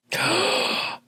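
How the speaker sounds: mock gasp. Mock shock before a pun.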